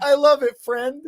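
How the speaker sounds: high, loud put-on cutesy voice; mock gushing reply. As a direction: gush back up high as a joke. Joking reply in same dog voice.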